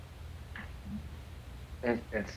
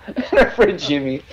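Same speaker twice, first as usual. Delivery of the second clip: louder, slower words broken by laughter; laughing; 7 semitones higher, 7 dB louder, slower than usual. Real, not acted. Guest introducing his friend into the story, laughing. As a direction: keep telling it through the giggles.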